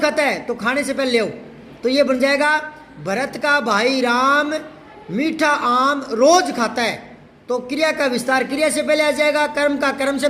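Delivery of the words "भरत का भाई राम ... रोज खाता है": drawn out, phrase by phrase; measured, deliberate classroom recital; slow model sentence. Shown as a demonstration. Teacher recites the built-up example sentence.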